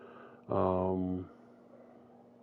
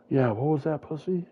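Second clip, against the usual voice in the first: loud mocking taunt; cocky taunt; 6 semitones higher, 5 dB louder than usual. Narrator taunting the knocked-out Charlie, cocky. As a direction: gloat over the beaten foe.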